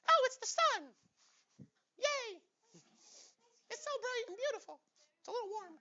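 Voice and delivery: very high cartoon squeak; squeaky sun voice. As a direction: play it like a voice squeezed up by the sun. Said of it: "maybe your voice would go really high".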